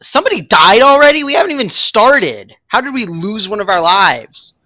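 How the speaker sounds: high-pitched disbelief with a laugh; shocked, voice jumping up; incredulous surprise. Real, not acted. Someone died before boss start.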